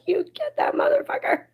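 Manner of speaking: high, breathless whispered cheer. Retold or imitated. Retelling cheering the movie's ending, high whispered cheer. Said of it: "I cheered".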